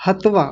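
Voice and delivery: "हत्वा" with one short त running into वा; correct single consonant. Shown as a demonstration. Teacher showing students the right way, "हत्वा" with single त. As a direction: say "हत्वा" as hat-vaa, a single t. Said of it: "बोला कैसे जाएगा? ध्यान दीजिए".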